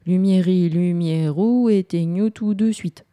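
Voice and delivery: drawn-out incantation; playful chanted magic formula; magic spell. Shown as a demonstration. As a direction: intone it like a wizard's spell. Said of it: "je vais en faire une autre".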